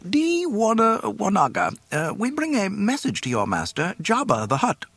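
high, formal robot announcing; official droid voice. Acted. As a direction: state it officially and nervously. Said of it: "spoke up officially".

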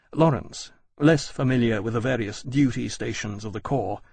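ordinary delivery

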